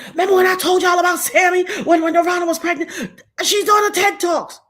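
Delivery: high, loud reenacted news-breaking; excited announcing. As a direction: blurt it out to everyone, thrilled. Acting out telling everybody the news.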